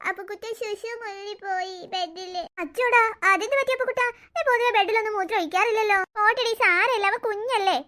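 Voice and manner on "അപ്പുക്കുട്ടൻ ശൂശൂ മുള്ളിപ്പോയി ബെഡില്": put-on slow, soft baby talk; tearful little boy. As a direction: confess slowly and quietly like a sad toddler. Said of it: "അവൻ കരയുന്നുണ്ടല്ലോ അമ്മേ". (കരയുന്ന കൊച്ചുകുട്ടിയുടെ പതുങ്ങിയ കുഞ്ഞുഭാഷ)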